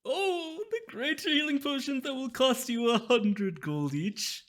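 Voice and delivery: high, loud, slow merchant; relishing the steep price; sly mysterious shopkeeper. Acted. Shopkeeper voice held.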